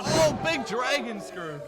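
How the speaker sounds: slow, loud, drawn-out call; excited shout. Real, not acted. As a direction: shout the move name in awe. Calling a big move live.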